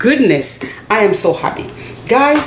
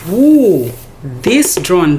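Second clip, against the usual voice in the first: normal talking voice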